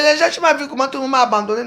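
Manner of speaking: ordinary delivery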